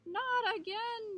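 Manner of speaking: high, loud yelp of dismay. A narrator's reading. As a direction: yelp it as danger returns. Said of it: "yelped Coyote".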